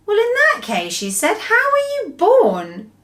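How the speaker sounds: put-on a puzzled girl asking; little girl voice. Sophie asking.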